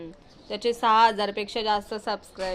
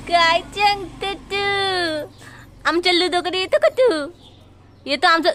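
pet talk; 5 semitones higher than usual. Real, not acted. Cooing at the kitten to come to Ludo, higher coaxing pet talk. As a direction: coax the kitten sweetly, stretching words.

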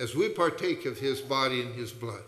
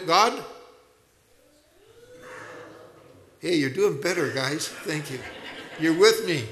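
higher, impassioned sermon voice; a pastor's rising, heartfelt preaching; fervent preaching; 6 semitones higher than usual. Real, not acted. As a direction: preach it out to the whole congregation. Preaching at full force.

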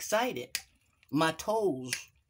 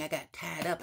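normal talking voice

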